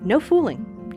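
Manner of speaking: ordinary talk, nothing marked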